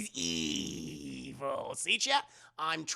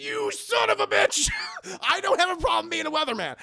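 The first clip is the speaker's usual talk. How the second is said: high, loud, hammed-up outburst; mock furious yell; 11 semitones higher, 6 dB louder than usual. Bill yelling at smug Jonas, hammed-up furious shout. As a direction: yell it like a wronged storm chaser.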